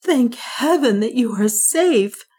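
high, slow and shaken; thankful through lingering fear; voice broken with fear. A narrator's reading. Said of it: "in a voice broken with fear".

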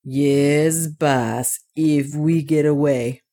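lightly voiced dwarf, slowing down; thoughtful dwarf. A narrator's reading. Otter answering his master, mindful of the ice bridge, thoughtful. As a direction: echo it slowly, with a touch of doubt. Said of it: "bethinking himself of the ice bridge".

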